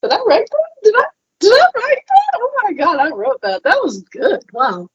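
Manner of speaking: high, disbelieving squeal on did I write that; amazed self-surprise. Retold or imitated. Rereading her own section and gasping at how good it is, high amazed voice. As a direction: act stunned at your own writing. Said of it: "I'm like".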